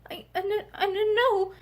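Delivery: broken, stuttering through sobs; sobbing. A narrator's reading. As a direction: choke the words out between sobs. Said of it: "Charity sobbed heartbrokenly".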